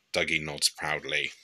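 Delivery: ordinary delivery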